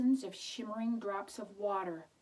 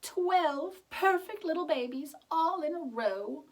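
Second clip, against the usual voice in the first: put-on delighted old woman; 8 semitones higher, 7 dB louder than usual. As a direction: marvel at the twelve babies.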